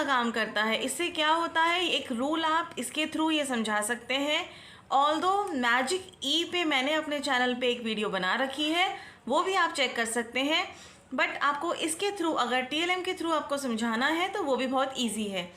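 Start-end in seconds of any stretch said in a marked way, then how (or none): none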